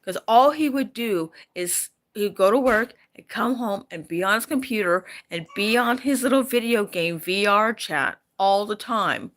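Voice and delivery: normal talking voice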